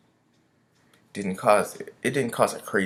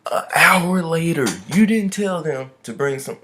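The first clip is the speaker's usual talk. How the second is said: louder and higher, slow, fed-up retort; exasperated complaint; 6 semitones higher, 7 dB louder, slower than usual. Retold or imitated. Griping at the KFC worker about the hour-long wait, loud and drawn out. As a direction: drag each word out to show how done you are.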